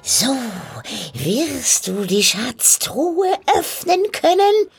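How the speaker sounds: high, slow sly hag voice; a crone spelling out her scheme; old witch. A narrator's reading. Witch explaining the treasure to the soldier, sly hag voice.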